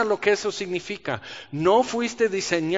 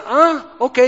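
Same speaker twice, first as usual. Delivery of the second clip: high, louder matter-of-fact voice of the brain; brain talking; 8 semitones higher, 6 dB louder than usual. Pretend. The brain deciding to release tears, casual. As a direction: play the brain shrugging it off. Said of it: "y dice".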